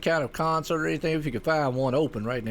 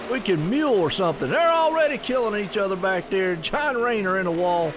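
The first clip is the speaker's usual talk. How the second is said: excited, higher live race call; race play-by-play; 4 semitones higher than usual. Acted. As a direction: call the race lap by lap with energy.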